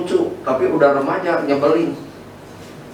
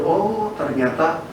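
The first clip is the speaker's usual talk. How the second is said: dawning realization; 3 semitones higher, 3 dB quieter, slower than usual. Retold or imitated. Acts out his own dawning realization.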